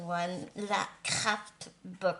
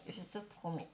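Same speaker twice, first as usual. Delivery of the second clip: much softer, trailing promise; soft repeat; 5 semitones lower, 10 dB quieter than usual. Real, not acted. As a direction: let the promise fade out quietly.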